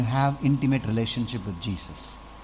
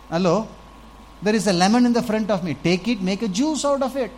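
exasperated appeal; 6 semitones higher than usual. Real, not acted. Mocking, calling congregation to attention.

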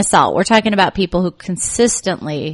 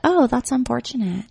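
low-energy acknowledgment; 5 semitones higher, 4 dB quieter than usual. Shown as a demonstration. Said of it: "not give it any energy".

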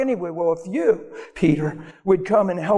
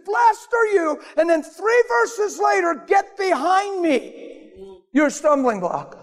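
full-force preaching; 10 semitones higher, slower than usual. Acted. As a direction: shout it out over the crowd.

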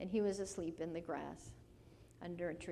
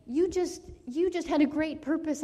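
raised, emphatic, moved voice; louder and higher, earnestly retelling a tearful moment; 7 semitones higher, 9 dB louder than usual. Retold or imitated. Speaker telling Tony he had a purpose, moved and emphatic. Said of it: "I started crying with him".

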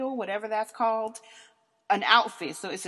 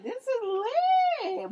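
hyped up; much slower than usual. Retold or imitated. Partying, hyped at the party.

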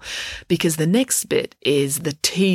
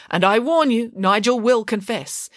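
a hint of a courtroom barrister, louder on "warn"; mock barrister; 7 semitones higher, 3 dB louder than usual. A narrator's reading. Friday cross-examining Mrs Cannon, mock barrister. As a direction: play a hammed-up lawyer warning the witness. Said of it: "getting caught up in the role".

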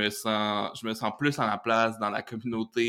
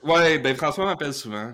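joking boast; 5 semitones higher, 6 dB louder than usual. Pretend. Joking about the premier calling.

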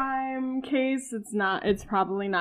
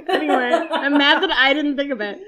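laughing; 3 semitones higher, 6 dB louder than usual. Real, not acted. Still laughing at friend's joke.

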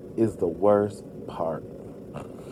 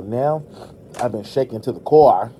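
ordinary delivery

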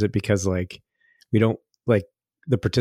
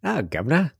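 hammed-up British accent, pitched higher; over-the-top British accent, higher voice; mock British accent; 8 semitones higher than usual. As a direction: play a cheeky Londoner asking for a king's body. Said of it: "I don't speak British, but my friend does".

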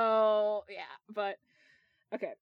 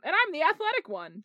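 proud, higher and louder boast; 8 semitones higher, 5 dB louder than usual. A narrator's reading. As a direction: chime in proudly at a raised pitch. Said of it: "James added proudly".

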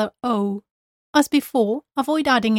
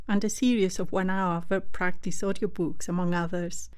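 soft promo read; 3 semitones lower, 7 dB quieter than usual. Acted. Teacher closing with a soft ad for audiobooks. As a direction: tell listeners about the free audiobooks.

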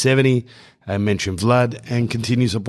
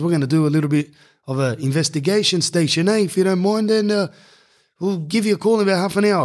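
put-on higher, lightly done Islander accent; 8 semitones higher, faster than usual. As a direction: greet him with a light Islander lilt. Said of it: "And he goes".

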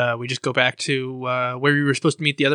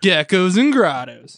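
announcer title call; 5 semitones higher, 4 dB louder, much slower than usual. Acted.

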